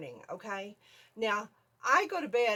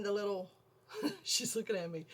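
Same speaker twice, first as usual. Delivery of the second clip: ordinary delivery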